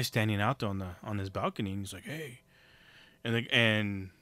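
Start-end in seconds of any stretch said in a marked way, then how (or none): 2.0-2.4 soft, hushed greeting from a lurker; a quiet creepy hello; creepy soft hey. Retold or imitated. Creep on balcony calls softly.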